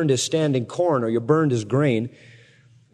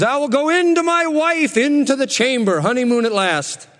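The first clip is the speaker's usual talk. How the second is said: higher, louder, gleeful mock; eager Samson; 9 semitones higher, 3 dB louder than usual. Samson arriving to claim his wife, gleeful. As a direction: announce it with eager glee.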